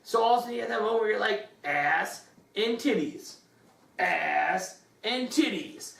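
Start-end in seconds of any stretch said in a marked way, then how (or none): 1.6-5.9 slow, drawn-out, relishing each word; slow savoring. Retold or imitated. To his co-host, slowly savoring the punchline. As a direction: stretch it out like a mock revelation.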